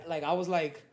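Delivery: usual speaking voice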